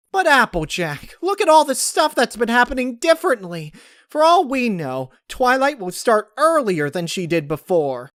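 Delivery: high, loud urgent pleading; urgent worry. Acted. Pinkie urging Applejack, high and loud with alarm. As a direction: press the danger on them. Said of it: "Applejack's eyes widened".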